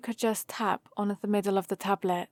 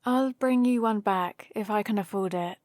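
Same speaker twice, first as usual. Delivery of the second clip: normal talking voice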